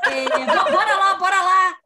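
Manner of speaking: imitating a friend who says everything twice, laughing loudly; laughing doubled-word mimicry. Mocking a friend's habit of repeating everything, laughing. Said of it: "ele fala tudo em dobro".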